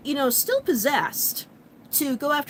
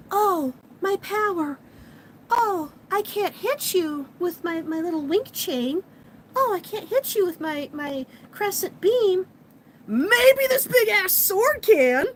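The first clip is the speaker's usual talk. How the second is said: hurt Sailor Venus; 7 semitones higher than usual. Retold or imitated. Sailor Venus, badly hurt, before grabbing the sword, hammed-up and high. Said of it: "Sailor Venus gets hurt really badly".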